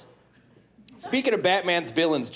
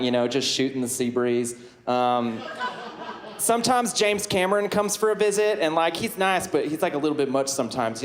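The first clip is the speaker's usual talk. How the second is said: put-on folksy Southern accent, teasing the crowd. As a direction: play a good-ol'-boy Southern Aquaman. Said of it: "why do you have a southern accent?".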